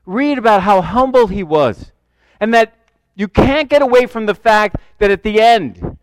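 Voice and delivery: ordinary delivery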